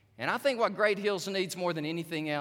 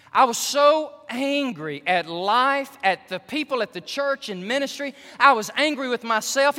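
angry confession; 7 semitones higher, 9 dB louder than usual. Retold or imitated. Preacher voicing a pastor's anger, loud and raised.

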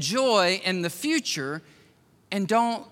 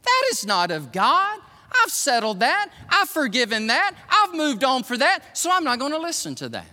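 firm refusal; 8 semitones higher, 3 dB louder than usual. Retold or imitated. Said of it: "or I can say".

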